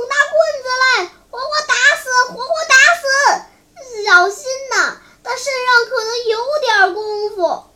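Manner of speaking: high, loud howls from a man kicked in the belly; pained yelling. A narrator's reading. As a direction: wail in pain while screaming orders. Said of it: "不住声地叫". (疼得不住声地高声叫骂)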